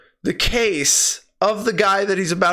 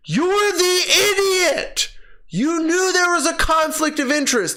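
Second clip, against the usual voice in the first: exasperated outburst; 11 semitones higher than usual. Real, not acted. Scolding the hero, voice breaking.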